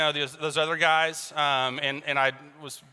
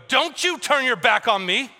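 mock-angry, higher-pitched demand; arguing; 6 semitones higher, 3 dB louder than usual. Arguer to spouse who walks away, mock-angry. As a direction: fire it after someone in an acted quarrel. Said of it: "you're arguing with somebody and then they walk away".

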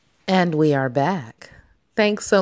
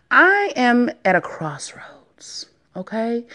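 whisper; 5 semitones higher, slower than usual. Real, not acted. Confiding her worries.

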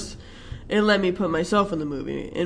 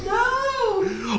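protesting no; 14 semitones higher than usual. Real, not acted.

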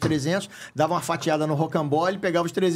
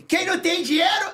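character catchphrase; 12 semitones higher than usual. Retold or imitated. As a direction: fire off the catchphrase pitched up.

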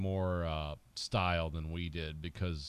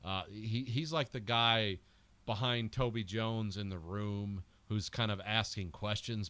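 usual speaking voice

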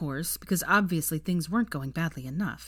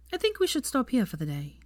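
firm, raised-pitch decision; decisive; 6 semitones higher than usual. A narrator's reading. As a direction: Hermione deciding for both of them, decisive. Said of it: "she said decisively".

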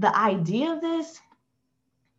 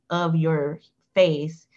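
ordinary talk, nothing marked

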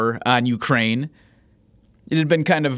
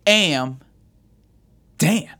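announcer intro; 7 semitones higher, much slower than usual. Acted. Show intro over music.

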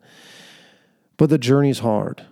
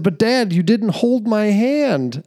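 put-on high, slow whiny kid complaining to dad; young son blaming his dad after slipping on the trail, mock whine; little boy voice; 9 semitones higher, slower than usual. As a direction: play the boy scolding his father. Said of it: "he said".

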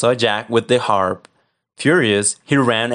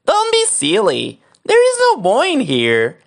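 much higher, thin wife voice; giant's wife; 12 semitones higher, 3 dB louder than usual. Acted. Wife hides Jack from the giant, high and innocent. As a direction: squeak it out innocently. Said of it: "said his wife".

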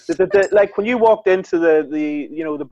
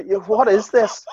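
slow, higher-pitched wonder; hammed-up disbelief; mock amazement; 5 semitones higher, slower than usual. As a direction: marvel like a baffled yokel. Teasing southerners as amazed yokels.

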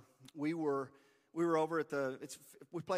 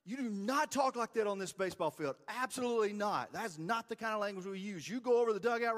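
put-on higher, firm telling-off of a boy; stern coach scolding; 7 semitones higher, 3 dB louder than usual. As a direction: scold the kid like a coach on the field. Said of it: "And I'm like".

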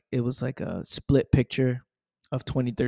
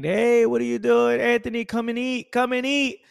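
high, loud call from the other room; parent calling to dinner; 9 semitones higher, 6 dB louder than usual. Retold or imitated. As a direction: call him to come and eat. Parents calling him to eat.

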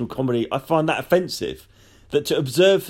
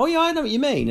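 agreeing; 12 semitones higher, faster than usual. Retold or imitated. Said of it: "people go".

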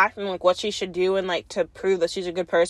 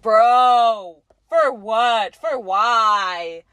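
exasperated disbelief; much slower than usual. Retold or imitated. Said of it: "I'm like".

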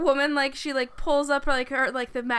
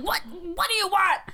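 higher, put-on voice of a harried man; movie man voice; 6 semitones higher than usual. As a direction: play a cornered man in an old film. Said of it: "the guy who's like".

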